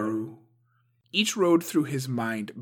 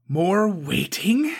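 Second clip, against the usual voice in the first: slow, scornful whispered spit; spat; 9 semitones higher, 8 dB louder, slower than usual. A narrator's reading. Suko scorns the council's patience, spat. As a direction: spit the words with contempt. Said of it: "Suko spat".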